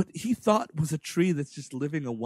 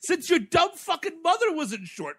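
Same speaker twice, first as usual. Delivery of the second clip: mock Miyagi rant; 9 semitones higher than usual. Miyagi ranting at LaRusso about the ripped-out tree. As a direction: rant with a laugh in the voice.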